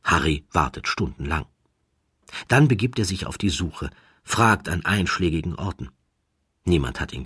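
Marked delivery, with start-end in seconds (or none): none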